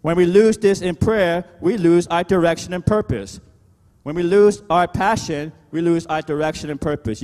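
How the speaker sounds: high, driving pulpit delivery; emphatic preacher's rallying call; preaching. Real, not acted. Preaches at full force.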